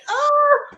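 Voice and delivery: high, loud imitated "ohhh"; mock-scandalised high whoop; crowd reaction "oh". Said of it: "everybody was like".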